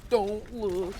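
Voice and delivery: a hammed-up cry to arachnophobes, don't look. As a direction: yell "don't look" high and loud as a jokey alarm. Warning arachnophobes about the spider.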